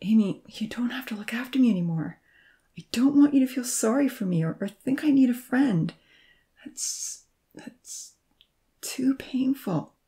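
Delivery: pained, halting whispered refusal; hurt whisper. A narrator's reading. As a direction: let the pain break the words. Hurt man refusing her.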